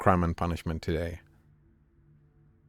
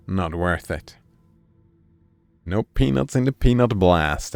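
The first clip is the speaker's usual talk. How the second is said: ordinary delivery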